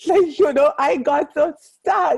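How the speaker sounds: a real laugh, high and loud. Real, not acted. Telling the audience about her stuck moment, laughing. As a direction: laugh bursting into the word like.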